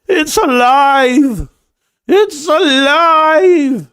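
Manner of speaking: very slow, high, loud exclaim; mad scientist. Pretend. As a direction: cry it out like Frankenstein's creator. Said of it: "you need people to say".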